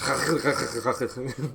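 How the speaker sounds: string of rasping guttural ch sounds; mock Dutch as repeated throat-scraping; imitated Dutch throat noises. Teasing how Dutch sounds to them, mock guttural rasping. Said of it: "when I hear actual Dutch speaking, all I hear is".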